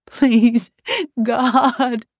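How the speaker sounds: high, loud tearful prayer; weeping plea. A narrator's reading. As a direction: sob out a plea to God. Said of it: "Daphne was weeping now".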